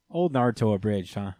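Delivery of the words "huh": ordinary talk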